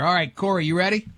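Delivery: usual speaking voice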